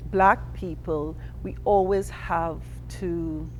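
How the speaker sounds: ordinary talk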